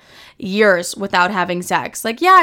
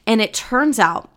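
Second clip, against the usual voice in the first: normal talking voice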